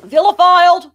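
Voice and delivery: loud high mocking repeat; mocking echo. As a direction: throw the word back at him. Mocking the word.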